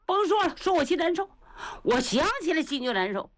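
high-pitched, welling-up distress; tearful grief. A narrator's reading. Old Niu remembers his sworn brother, tearfully upset. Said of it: "眼圈当时红了".

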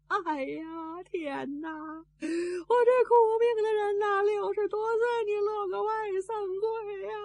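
slow, drawn-out theatrical wail; keen each phrase out in a rising cry; wailing widow. A narrator's reading. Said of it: "哇的一声就哭出来了". (拖着长腔嚎哭)

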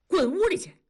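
high, loud barked order; sharp shout. A narrator's reading. Zhuang's wife orders Gouzi inside, sharp loud shout. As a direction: bark the order at the boy. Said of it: "冲着狗子一声喝".

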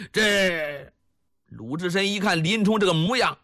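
ordinary delivery

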